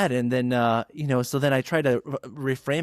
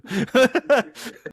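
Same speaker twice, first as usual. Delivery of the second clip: high laugh; 13 semitones higher, 5 dB louder than usual. Real, not acted. Laughing at own story.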